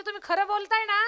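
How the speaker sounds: high, loud eager question; eager wife. Acted. Wife given leave to sell, eager question. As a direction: ask with rising excitement. Wife checks his permission.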